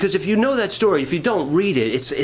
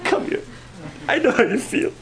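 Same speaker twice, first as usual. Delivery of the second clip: put-on high, soothing baby-talk pity; hammed-up "you poor baby" coddling; mock pity; 8 semitones higher, 5 dB quieter than usual. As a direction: coo like someone babying a crybaby. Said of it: "I wanted somebody to say".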